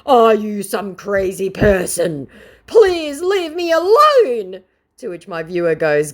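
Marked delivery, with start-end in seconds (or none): 0.1-4.6 high, loud, slow whining plea; mock scammer voice. Vlogger mocking the scammer's whining, high and loud. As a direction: whine it up high, slowing on the plea. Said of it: "the scammer then put in".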